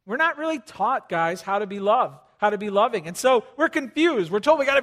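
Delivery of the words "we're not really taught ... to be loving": ordinary delivery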